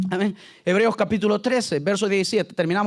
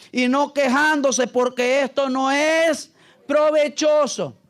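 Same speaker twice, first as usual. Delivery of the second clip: slow, raised preaching voice with weighty pauses; scripture declamation; 4 semitones higher, slower than usual. Acted. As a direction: proclaim each phrase of the verse deliberately. Reads scripture at full force.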